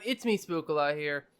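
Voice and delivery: ordinary talk, nothing marked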